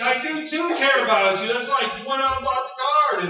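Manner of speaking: high, loud indignant protest; husband insisting to wife that he cares, loud. Retold or imitated. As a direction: insist you care, indignant.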